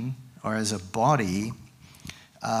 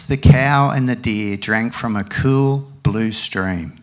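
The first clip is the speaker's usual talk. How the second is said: animated picture-book reading with raised, loud dialogue; story reading to a child; 5 dB louder than usual. Acted. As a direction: perform the animals' argument, drop for the tags. Reads picture book aloud.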